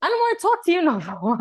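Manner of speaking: high, fast, laughing huff; upset and fed up. Retold or imitated. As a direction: sulk at the doctor half laughing. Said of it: "I'm like".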